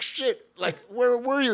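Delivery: very high, loud re-enacted shock; astonished. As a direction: blurt it out high and incredulous. Acted shock at finding Graham.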